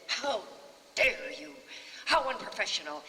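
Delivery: loud indignant rebuke; mock-furious actor's outburst; ranting outrage. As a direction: scold her in front of the crew. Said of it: "ranted and raved".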